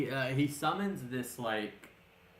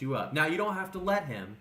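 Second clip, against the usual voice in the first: ordinary talk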